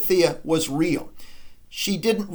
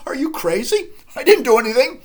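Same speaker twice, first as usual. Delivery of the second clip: high, loud indignant denial; mock outraged denial; 9 semitones higher, 6 dB louder, faster than usual. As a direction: Hedda snapping back in feigned innocence. Hedda protesting innocence.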